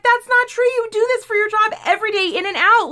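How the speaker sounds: high-pitched, quick, loud retort; indignant protest. Retold or imitated. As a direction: argue back in a rising outburst. Retold argument, protesting to salesman.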